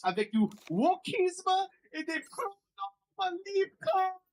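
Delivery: high, slow, hammed-up French accent; mock French accent. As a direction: read it like an outraged Parisian. Mocking French tweet read aloud.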